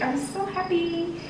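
softly gushing I'm so happy; delighted. Real, not acted. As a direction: glow with joy. Delighted with cake.

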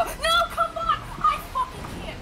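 high, strained cry of defeat; frustrated high wail. Real, not acted. Frustrated losing in game.

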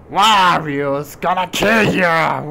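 drawn-out, loud villain taunt; loud, slow mock threat ending in a laugh; mock menacing threat. Player teasing that Wario's gonna kill, mock menacing.

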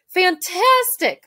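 high, loud, drawn-out gush; slow, high-pitched emotional exclamation. A narrator's reading. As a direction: echo the praise, overcome with joy. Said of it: "so emotional she could barely talk".

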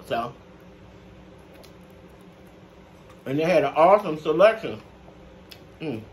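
chewing while talking; talking between bites; mouth full. Real, not acted. Seen 1: Bit food just before; mouth working while speaking over plate.

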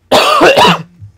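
loud hacking cough; harsh cough. Real, not acted. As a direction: cough hard, choking. Choking on food after pull.

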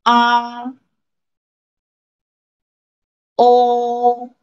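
each vowel held long on a high level tone; model for repetition. Shown as a demonstration. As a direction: sustain each pinyin vowel evenly, pausing for learners to repeat. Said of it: "please read after me".

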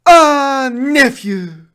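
loud, high delighted call; delighted greeting. Acted. Scrooge greeting nephew Fred, loud and delighted. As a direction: greet him with open arms.

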